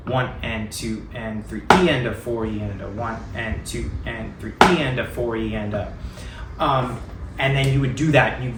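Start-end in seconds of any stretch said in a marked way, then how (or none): 0.1-5.9 counting aloud, leaning on the E after three; accent on the E of three. Shown as a demonstration. Drum teacher counting out the shifted accent, stressing the E of three. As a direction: stress the E of three, not three itself. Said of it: "put the accent, instead of on three, shift it down one sixteenth note, place it on the E of three".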